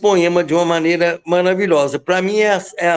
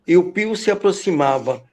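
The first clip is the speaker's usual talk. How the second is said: lower, hushed incantation of the poem; hushed poem recitation; 4 semitones lower than usual. Acted. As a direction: draw listeners in, lower and hushed. Recites opening of poem again. (declamação mais grave e contida)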